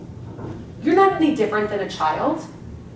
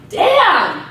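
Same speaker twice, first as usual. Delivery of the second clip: surprised realization; 5 semitones higher, much slower than usual. Retold or imitated. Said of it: "one day you're like".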